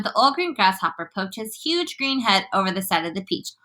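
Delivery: ordinary delivery, nothing marked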